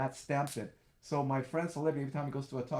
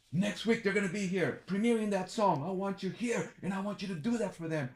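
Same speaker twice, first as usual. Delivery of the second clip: higher-pitched, eager boss voice; excited instructions; 8 semitones higher, 4 dB louder than usual. Retold or imitated. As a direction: act out a host eagerly giving orders. Acting out Dick Clark giving orders.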